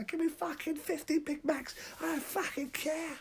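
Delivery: very high, crazed squeal; manic customer. Acted. Madman at the McDonald's counter, crazed squeal. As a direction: demand Big Macs like a lunatic.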